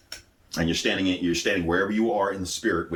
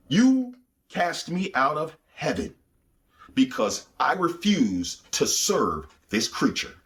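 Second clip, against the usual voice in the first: higher, very slow, strange-toned demon voice; accusing demon; 6 semitones higher, slower than usual. Retold or imitated. Fallen angel accusing God over his fall, slow and high. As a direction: drag each word out like a bitter fallen angel.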